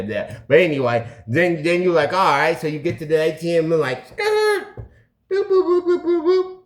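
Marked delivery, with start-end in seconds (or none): 4.1-4.8 very high squealing skirt; tire screech. Retold or imitated. Pulling up to the ATM, a high tire screech. 5.3-6.6 very high boop boop beeps; button beeps. Retold or imitated. Punching in the ATM code, high little beeps.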